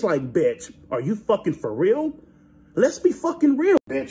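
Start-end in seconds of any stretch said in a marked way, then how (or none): none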